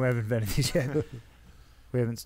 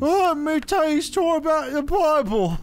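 high, put-on Cockney peasant voice; mock Cockney storyteller; Cockney accent; 13 semitones higher, 3 dB louder than usual. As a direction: play a chirpy Cockney villager. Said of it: "doing the Cockney accent".

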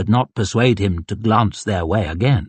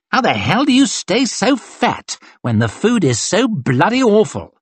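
high, incredulous taunt; exasperated officer ribbing a subordinate; public mockery; 10 semitones higher than usual. A narrator's reading. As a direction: play the insult to the crowd. Colonel mocks soldier before laughing crowd.

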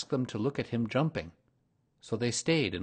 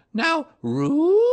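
very high, louder motherly kangaroo voice; 12 dB louder than usual. A narrator's reading. Kanga coaxes Baby Roo to jump once more, very high. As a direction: coax a little one in a high sing-song.

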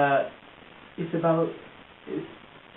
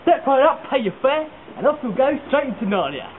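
high, loud showman's patter; fairground barker; 7 semitones higher, 5 dB louder than usual. Acted. Showman selling the Gateway to Narnia, fairground barker. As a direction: hawk it to the crowd like a sideshow caller.

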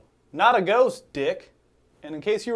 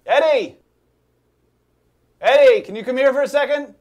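calling out; 4 semitones higher, 10 dB louder than usual. Acted. Calling roommate from bathroom.

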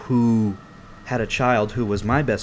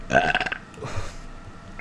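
low, croaking belch; throaty unvoiced burp; 10 semitones lower, 3 dB quieter than usual. Real, not acted. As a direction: let out a burp mid-sentence. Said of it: "sorry, a lot of people hate when I burp".

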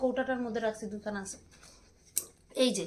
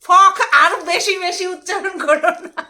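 scolding loudly; 6 semitones higher, 12 dB louder, slower than usual. Real, not acted. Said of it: "scolding over pronunciation".

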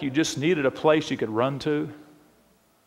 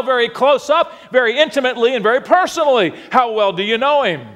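preaching; 8 semitones higher, 4 dB louder than usual. Acted. Preacher urging the congregation to know God, preaching.

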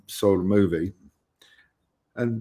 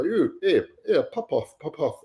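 a retold English accent shooing fans off, raised pitch; 6 semitones higher than usual. Security guard shoos American fans away, imitated English accent. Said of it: "you know, in his whole accent".

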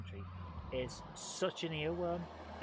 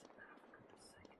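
whispered under the breath; fed-up whispered grumble; exasperated whisper; 23 dB quieter than usual. Real, not acted. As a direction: hiss it in frustration. Frustrated at dying again.

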